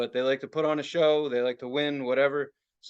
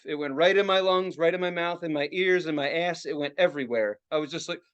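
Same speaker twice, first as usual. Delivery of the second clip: usual speaking voice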